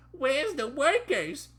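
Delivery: very high, slow timid question; mock trembling voice. Trainee looking for staff, hammed-up trembling voice. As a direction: stretch it out in a squeaky, nervous voice. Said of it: "in a trembling voice".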